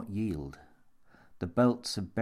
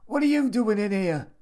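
high, loud repeated challenge; demanding shout; 13 semitones higher, 7 dB louder than usual. A narrator's reading. Threatening man confronts helplessly laughing Jimmy, demanding shout. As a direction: demand it loudly, pitched right up. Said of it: "The voice demanded again".

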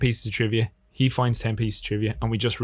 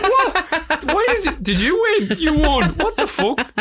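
much higher, yelped disbelief; stunned disbelief. Retold or imitated. A winner hearing his film's name called, stunned high yelp. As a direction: squeal it like you can't believe you won. Retelling shock at winning.

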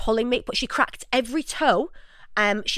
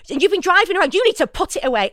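higher, louder stern telling-off; raised scolding; 8 semitones higher, 5 dB louder than usual. Retold or imitated. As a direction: scold him, pitched up. Telling off a flasher.

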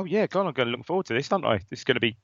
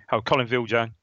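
ordinary delivery

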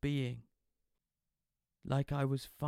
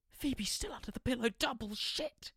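panicked thought; 11 semitones higher than usual. Acted. George realising his girlfriend is still on the line, panicking. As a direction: panic under your breath.

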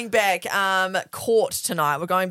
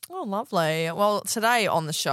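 ordinary delivery

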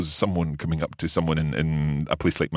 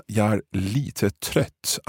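Swedish "Jag är lite trött" said very slowly, each word spaced out; slow teaching model; 2 semitones higher, much slower than usual. Shown as a demonstration. Teacher models the Swedish line slowly for listeners. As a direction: spell it out word by word for the learner. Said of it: "So that lite is the same lite".

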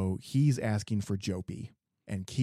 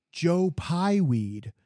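"Joe Pieweed" stretched slow and higher, louder; mock delighted recognition; 8 semitones higher, 5 dB louder, much slower than usual. The clerk finally recognizing the plant name, slow delighted drawl. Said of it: "is like, Oh".

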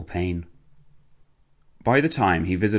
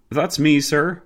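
voiced Walter; 7 semitones higher, 4 dB louder, slower than usual. A narrator's reading. Walter answers the driver.

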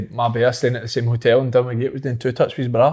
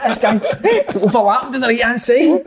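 very high, laughing mock tactics talk; laughing impersonation; 10 semitones higher than usual. Footballers drilling tactics with dinner condiments, laughing high impersonation. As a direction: imitate keen footballers while cracking up.

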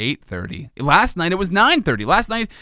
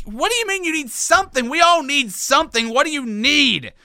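exasperated parent; 10 semitones higher than usual. Retold or imitated.

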